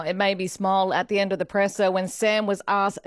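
ordinary delivery

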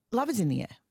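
usual speaking voice

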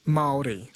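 "Maori" starting like the word mole; correct Maori pronunciation. Shown as a demonstration. Host repeating it for a caller, "Maori" pronounced with mole sound. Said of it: "it's pronounced Maori".

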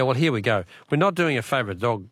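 ordinary talk, nothing marked

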